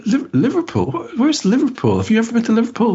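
very high-pitched mock upper-crust women; posh female characters. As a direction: imitate two baffled society ladies in falsetto. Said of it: "especially the two female characters".

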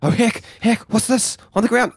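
high, startled little voice; alarmed character voice. Acted. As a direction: gasp it out in alarm. Trevor character finds something.

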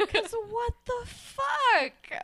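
high, long drawn-out incredulity; exasperated disbelief. Real, not acted. Podcaster reacting to the bizarre photo of both girls, drawn-out disbelief. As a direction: stretch it out high, utterly baffled.

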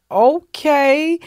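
high, drawn-out, rising uncertainty; acting out being caught off guard; thrown off. Comedian recalls reacting to the surprise dinner request, thrown off. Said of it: "he really threw me off".

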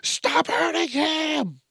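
high, drawn-out wailing plea; mock screaming bystander. Onlooker yelling at a bear in a video, mock screaming. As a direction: imitate a hysterical onlooker's slow, high cry. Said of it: "why is someone always screaming and crying".

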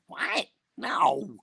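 high, baffled what and no; mock disbelief, pitch jumping up; incredulous. Incredulous reply to shoot it.

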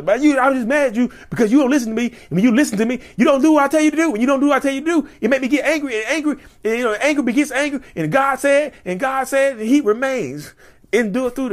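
imitating a raging narcissist's nonsense; word salad rant. Mocking a narcissist's word salad anger at a partner, high and rambling.

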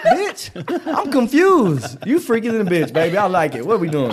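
hammed-up bewildered shout; mock confused outburst. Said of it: "And I'm like".

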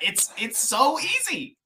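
high, drawn-out incredulous quote; mock disbelief. Fans laughing at a first-drive run, in mock disbelief. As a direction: exclaim it way up high, stretching the words.

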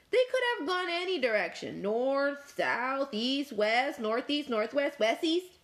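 high, fast list of directions; rattling Fork. Acted. Fork listing every direction to grumpy Dog, fast and high. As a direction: rattle them off quickly. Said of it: "You sure are a grumpy".

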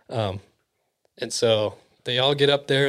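ordinary delivery, nothing marked

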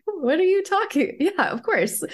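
high-pitched imitated incredulity; light, high, obvious-answer tone; baffled reassurance. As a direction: play a partner surprised you'd even ask. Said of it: "And he was like".

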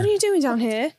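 put-on British accent. Smoke monster caught cooking tea, comic British accent. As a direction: ask it as a comic Brit. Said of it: "It's definitely a British".

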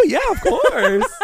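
slow, high, laughing yes; laughing worker reply. Retold or imitated. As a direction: laugh through it, slowly.

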